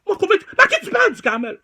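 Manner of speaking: high, fast, loud indignant squeal; indignant outburst. Acted. Scolding du Carmel, fast high indignation. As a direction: fire it off in disbelief.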